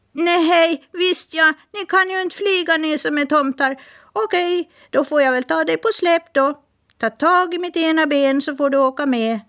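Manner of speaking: high, quick parrot voice; chatty parrot. Acted. Parrot introducing herself to Smirke, high bird voice. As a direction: rattle it off like a talkative bird. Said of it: "sa Polly".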